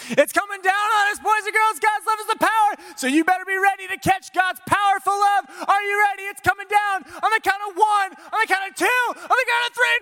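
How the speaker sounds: put-on very high, hollered kids' rally; frenzied countdown hype. Leader warning boys and girls it's coming down, high shouting. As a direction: whip the children up for the catch.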